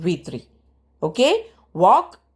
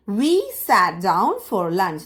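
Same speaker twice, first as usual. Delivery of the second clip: slow model reading; much slower than usual. Shown as a demonstration. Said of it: "teacher models English sentence".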